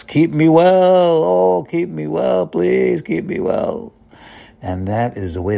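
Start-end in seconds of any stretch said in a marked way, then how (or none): 0.0-3.0 pleading. Acted. Acted pleading prayer to God. 3.0-3.9 breathy whispered prayer, trailing off; whispered plea. Acted. Rabbi acting out a prayer, whispered plea. Acted pleading prayer to God.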